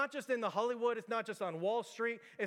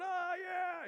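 high, drawn-out mock cheer; crowd cheering; 10 semitones higher than usual. Party guests cheering the birthday reveal, high mock cheer. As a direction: imitate a party crowd going wild, voice pitched way up. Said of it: "everybody's going".

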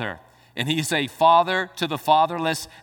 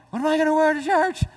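fretting churchgoer; 12 semitones higher, 4 dB louder, faster than usual. Retold or imitated. Sunday morning churchgoer fretting.

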